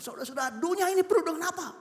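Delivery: high-pitched rising pulpit question; sermon opener climbing high; preaching pastor. Acted. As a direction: act out a pastor throwing a question to the congregation. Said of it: "pas itu pendeta khotbah".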